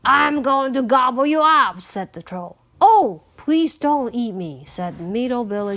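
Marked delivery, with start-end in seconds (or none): 0.0-1.8 loud troll threat, swelling to the end; menacing troll. A narrator's reading. Troll threatens the middle goat. 2.8-4.6 pleading middle goat, fairly loud; middle-sized voice. A narrator's reading. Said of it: "in a middle-sized voice".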